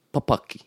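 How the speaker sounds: "papaki" stressed on the middle pa; short first syllable, stressed second in "papaki". Shown as a demonstration. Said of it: "Other words like this".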